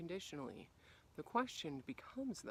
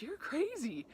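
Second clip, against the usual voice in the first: higher mock teasing "You're crazy"; teasing quote; 11 semitones higher, 4 dB louder than usual. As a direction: toss it off like a friend teasing. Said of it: "And they're like".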